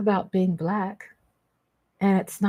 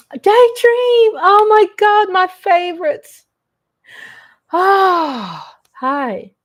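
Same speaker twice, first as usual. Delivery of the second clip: high, loud delighted squeal; real joy at seeing a favorite; excited greeting; 10 semitones higher, 8 dB louder, much slower than usual. Real, not acted. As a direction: greet her like a long-lost friend.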